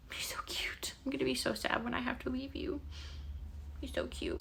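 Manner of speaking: soft unvoiced whisper; whispering fondly at the sleeping cat. Real, not acted. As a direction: breathe the words over a sleeping kitten. Whispering near sleeping kitten.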